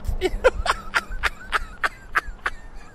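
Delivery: long rising squeal of laughter; high laughing fit. Real, not acted. Host laughs at his own joke, high squealing laugh. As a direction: lose it completely, squealing.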